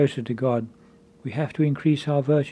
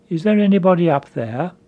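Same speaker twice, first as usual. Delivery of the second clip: louder call, pitched up, ending on a rise; shouted call upward; 4 semitones higher, 6 dB louder than usual. Retold or imitated. Man hanging on a tree yells up the cliff. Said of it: "and he shouted out".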